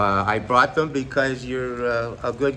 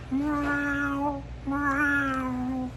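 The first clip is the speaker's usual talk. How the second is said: high, soft imitated cat meow; 13 semitones higher, 4 dB quieter than usual. Shown as a demonstration. Comedian demonstrates his cat trick, high cat meow. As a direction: mew like a little cat, very high. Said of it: "make a mountain lion out of a cat".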